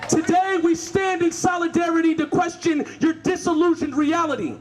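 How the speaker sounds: fierce speech read aloud; protest speech. Acted. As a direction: call out the official by name. Reads protest speech to official.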